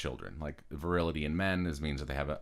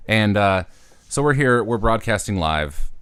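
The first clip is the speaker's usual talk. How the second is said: loud announcer; 4 semitones higher, 12 dB louder, slower than usual. Acted. Host announcing live.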